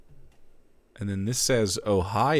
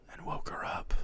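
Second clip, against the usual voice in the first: whisper; 11 dB quieter than usual. Acted. Whispered narration line.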